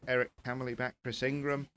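ordinary delivery

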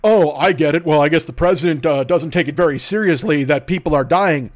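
sarcastic low dopey impression; mock naive voice. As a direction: parody someone just realizing. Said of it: "that construct of".

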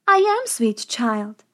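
put-on slow, sugary old woman voice; old farmer's wife. A narrator's reading. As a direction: coax the girl, slowly and kindly. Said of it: "said the woman".

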